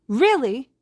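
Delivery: high, loud delighted "Really?"; thrilled squeal of surprise; excited cry. A narrator's reading. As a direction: burst out with joy. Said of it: "Himiko cries".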